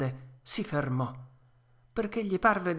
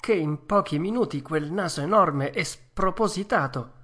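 ordinary delivery

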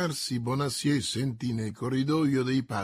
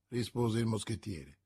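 plain reading voice

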